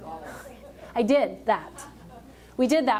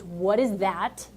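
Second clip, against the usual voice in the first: slow, lower, stunned question; daughter's disgust; 3 semitones lower, much slower than usual. Retold or imitated. Daughter leaving the airport into humidity, slow stunned disgust. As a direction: drag it out like hitting wet air. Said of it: "She goes".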